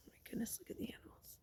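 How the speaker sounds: soft unvoiced whisper of awe; whispered wonder. Teacher points out the hidden animals, awed whisper. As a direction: hush the class with amazement. Shows picture to children.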